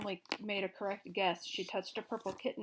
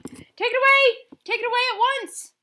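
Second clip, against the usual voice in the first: much higher, much louder panicked scream; 16 dB louder than usual. A narrator's reading. Gnome King panicking about the egg under his throne. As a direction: shriek in panic.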